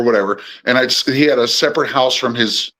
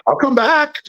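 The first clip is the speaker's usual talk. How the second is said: joking; 12 semitones higher than usual. Retold or imitated. Joking reply to old boss.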